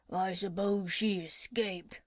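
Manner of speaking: slow, grumbling crocodile voice; a lightly voiced crocodile grumbling. Crocodile grumbling to the doctor about the parrot, slow grumble. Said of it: "grumbled the crocodile".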